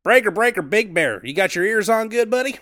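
loud, high trucker calling over a CB radio. Acted. Playing Rubber Duck, hailing Big Bear on the CB radio, loud and high. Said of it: "you'll be playing the part of Rubber Duck".

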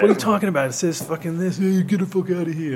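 loud, high mock outrage; imitated indignant seller. Acting fireworks seller dismissing a cheated kid, indignant.

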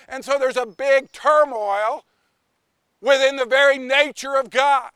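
usual speaking voice

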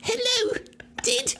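put-on high Australian accent with laughter; Australian son. Australian son answering his dad, Australian accent. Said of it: "my son that was born in a different part of the world".

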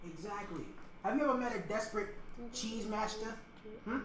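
a lightly put-on Italian-American accent with a wiseguy swagger; Italian mobster accent. Mobster pitching a cheesemaker to Paulie, in a light Italian-American accent. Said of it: "I'm still doing, like, the mannerisms".

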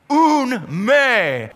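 "Un me" said as Greek, high and set apart; Greek phrase. Shown as a demonstration. Preacher quoting Greek to congregation, foreign sounding. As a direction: give "Un me" as a Greek word. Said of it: "it is a double negative in the Greek language".

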